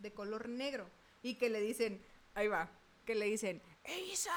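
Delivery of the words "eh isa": monster voice. Retold or imitated. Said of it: "con esa voz me lo dijo".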